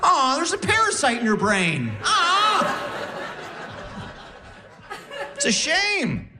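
high, cooing sympathy; baby talk at her, voice way up; hammed-up aww; mock pity. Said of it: "mock pity".

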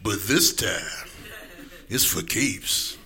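low, slow trailer announcer; low slow epic trailer read; movie trailer voice. Shown as a demonstration. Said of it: "sound like movie trailer terms".

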